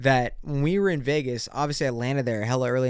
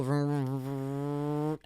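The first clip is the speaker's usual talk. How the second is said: drawn-out, soft engine vroom; motorcycle engine noise; 6 dB quieter, much slower than usual. Retold or imitated. Podcaster mimicking a cop's motorcycle, revving vroom noise. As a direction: imitate a motorcycle rumbling along.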